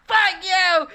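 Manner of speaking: high, loud, crying-out mock rage; over-the-top screamed refusal; mock furious shout. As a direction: scream it like a tantrum at the boss. Mock outburst at boss.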